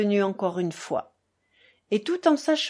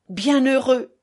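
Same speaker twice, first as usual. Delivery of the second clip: raised, louder heartfelt exclamation; exclaimed with feeling; 4 semitones higher, 6 dB louder, slower than usual. A narrator's reading. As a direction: cry it out fervently. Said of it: "s'exclama-t-il avec sentiment". (exclamation pleine de sentiment)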